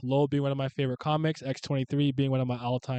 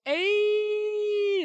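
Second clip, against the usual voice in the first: very high, drawn-out "Ay"; hype "ay"; 3 dB louder than usual. Shown as a demonstration. Explaining the slang to chat, high hype "ay". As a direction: sing out "Ay" like something's hype. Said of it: "Or if you're like".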